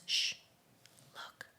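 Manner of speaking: hushed whisper. Retold or imitated. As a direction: whisper it so the gorillas stay calm. Guide whispers near gorillas.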